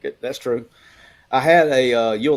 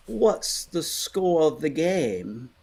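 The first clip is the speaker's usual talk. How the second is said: slow, drawn-out Southern old lady asking, lightly voiced; a sweet Southern grandma in the stands, slowed way down; Southern grandmother voice; 4 semitones higher, 3 dB quieter, much slower than usual. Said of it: "in her best Southern voice of all time".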